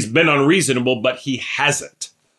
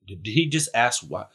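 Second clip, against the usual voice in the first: stunned aside; 3 semitones lower, 8 dB quieter than usual. Retold or imitated. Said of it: "I'd have been like".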